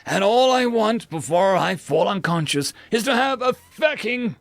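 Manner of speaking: overworked crewman yelling at a food dispenser, furious; desperate angry rant. Acted. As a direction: plead and rage, exhausted. Exhausted customer's rant.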